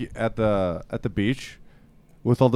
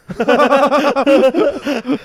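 loud high laugh; 14 semitones higher, 8 dB louder than usual. Real, not acted. Friend laughing hard at his own British joke.

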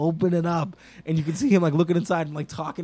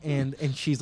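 normal talking voice

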